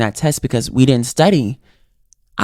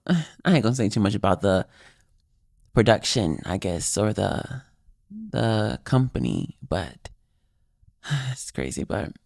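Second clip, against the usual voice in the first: sighing, guarded undertone, much softer and lower; reluctant aside; 7 semitones lower, 9 dB quieter than usual. Real, not acted. Speaker holding back about the production company, reluctant low aside. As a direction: trail off low and quiet, with sighs.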